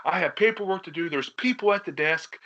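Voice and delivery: high, mock-irate protest; dad flipping out. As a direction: imitate a dad losing his patience. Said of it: "He's flipping".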